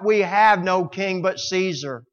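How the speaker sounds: loud, high cry of the people; yelling crowd. Retold or imitated. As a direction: yell it out as a defiant crowd. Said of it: "the people yelled out".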